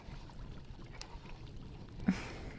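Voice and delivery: soft dark breathy chuckle; sinister chuckle. Acted. Witch chuckling softly over the villagers' fate, sinister chuckle. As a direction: laugh under your breath at a grim secret. After hinting at violent fate.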